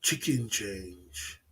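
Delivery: slow low mocking "Chicken change"; savoring phrase. Shown as a demonstration. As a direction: murmur "Chicken change" slowly as a sign-off. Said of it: "There you go".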